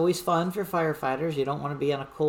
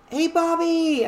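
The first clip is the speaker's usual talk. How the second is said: high, loud friendly call across camp; yelling a greeting; 12 semitones higher, 6 dB louder, slower than usual. Retold or imitated. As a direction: shout her name out to greet her. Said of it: "I hear people yelling".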